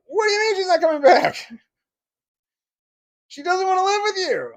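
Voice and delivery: high, loud mocking ex-wife; high mock whine of an ex-wife; mock shrill disbelief. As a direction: squeal her disbelief in a mock voice.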